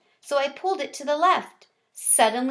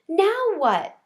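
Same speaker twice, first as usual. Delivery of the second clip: excited; 8 semitones higher, 4 dB louder than usual. Acted. Gigi excited after escape.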